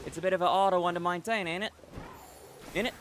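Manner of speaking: light Australian accent, quick; Aussie accent. Shown as a demonstration. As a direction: keep the Australian twang going on ain't it. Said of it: "practice my Aussie accent".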